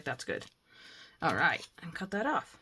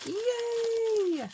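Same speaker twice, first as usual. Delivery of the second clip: high delighted squeal; excited cheer. Real, not acted. Crafter cheering as she pulls in a cluster, high squeal. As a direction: squeal with joy.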